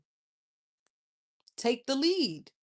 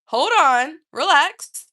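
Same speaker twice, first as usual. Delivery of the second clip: loud, firm hold on; cutting him off; 3 semitones higher, 10 dB louder, slower than usual. Retold or imitated. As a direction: stop him loudly mid-question. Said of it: "I was like".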